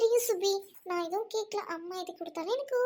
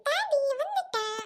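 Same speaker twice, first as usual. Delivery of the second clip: put-on little girl calling; 5 semitones higher than usual. Child calls father.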